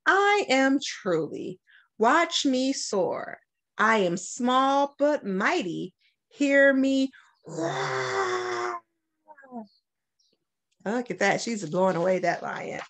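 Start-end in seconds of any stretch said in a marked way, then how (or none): 0.1-8.8 put-on lively, sing-song read-aloud for kids; bright picture-book reading voice; reading to children. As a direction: read it like story time for young kids. Principal reads picture book aloud.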